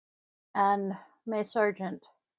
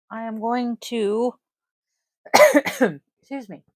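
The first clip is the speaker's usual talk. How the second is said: ordinary talk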